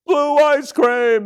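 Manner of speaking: very high, loud, stretched cheer; group yell. A narrator's reading. Class cheers for dessert, yelling high and slow. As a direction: yell it out like thrilled kids. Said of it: "they all yelled".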